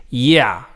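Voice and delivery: YEAH high, then dropping low; falling tone. Shown as a demonstration. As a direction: hit YEAH high and let it fall. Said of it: "from a high pitch to a low pitch".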